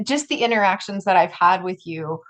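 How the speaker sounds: ordinary talk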